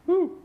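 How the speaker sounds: a high owl's hoot. Acted. Poet voicing the owl again, a very high hoot. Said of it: "The owl".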